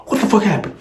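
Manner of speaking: high-pitched startled demand; alarmed mother. Retold or imitated. Mom finds winded kids at home, high mock-alarmed question.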